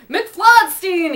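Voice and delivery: high, loud yell of the name. A narrator's reading. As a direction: yell the name across the office. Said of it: "he yelled".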